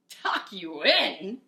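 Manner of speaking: loud, slow outraged bellow; pirate captain scoffing at bedtime. Acted. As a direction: roar the question back. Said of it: "he bellowed".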